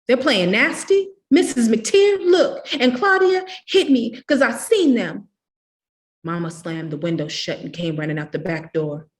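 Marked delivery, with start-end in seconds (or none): 0.1-5.3 high, loud, pleading shout; a child loudly telling tales to the mother; shrill tattling. A narrator's reading. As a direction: get the grown-up on your side. Child keeps tattling to Mama.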